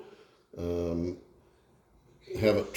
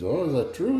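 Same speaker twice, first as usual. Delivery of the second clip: high-pitched, lightly Cajun question; Cajun accent; 9 semitones higher than usual. Acted. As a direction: ask with a hint of Cajun wonder. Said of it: "you guys wanna hear a Cajun joke".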